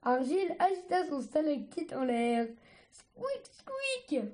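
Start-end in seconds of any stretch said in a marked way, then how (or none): 2.9-4.2 high, soft squeaking noises; scavenger squeaks. Acted. Argile imitating a scavenger, squeaking high. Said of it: "bruits de charognards".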